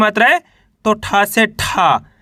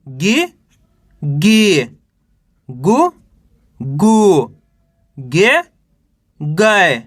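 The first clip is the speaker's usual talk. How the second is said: lower, each syllable set apart by pauses; syllable drill; 4 semitones lower, much slower than usual. Shown as a demonstration. Teacher reciting the ga row to students, lower and deliberate.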